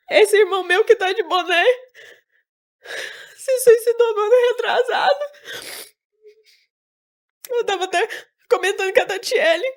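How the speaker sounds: crying. Real, not acted. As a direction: voice high and breaking with tears, sob-laughs between words. Brother's suicide, crying. (voz chorosa, embargada, entre soluços e risos nervosos)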